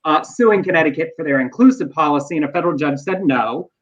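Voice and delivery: ordinary talk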